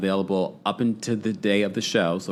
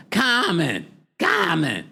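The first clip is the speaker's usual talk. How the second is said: higher, calling Common with a trill; Mama Joyce impression; 8 semitones higher, much slower than usual. Imitating Mama Joyce's voicemail, calling Common higher. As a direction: sing out the name like a scolding mother. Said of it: "my voice impression of it".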